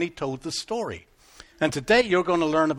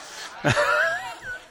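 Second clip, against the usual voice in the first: brief laugh. Real, not acted. Laughs after the story.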